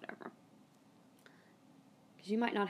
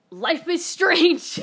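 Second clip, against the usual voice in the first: high, loud, drawn-out announcement; slow, booming mock trailer voice; dramatic title drop; 9 semitones higher, 12 dB louder, much slower than usual. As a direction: ham it up like a game title. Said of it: "I had to say it. I couldn't resist".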